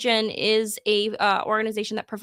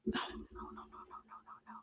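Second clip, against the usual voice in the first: fading whisper; 21 dB quieter than usual. Real, not acted. Trails off, tipsy from whiskey.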